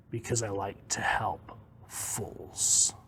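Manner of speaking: slow, hushed, breathy reply; cool disdain. Acted. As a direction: answer slowly, almost whispering. Barda coolly answers Scott's question.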